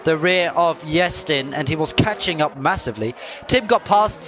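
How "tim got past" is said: excited commentary. Real, not acted. Overtake seen live.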